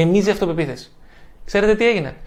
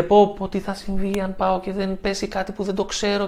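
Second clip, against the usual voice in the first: anxious self-talk; 2 semitones higher, 3 dB quieter than usual. Retold or imitated. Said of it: "Μην πείτε".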